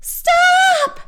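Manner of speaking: very high, loud shriek; piercing high shout; panicked yell. A narrator's reading. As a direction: yell to halt it at once. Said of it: "yelled Stink".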